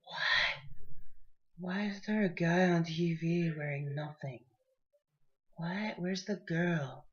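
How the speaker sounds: put-on hushed, baffled drunk mumble; mock the confused drunks, half under breath; confused drunk people. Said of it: "they literally just like".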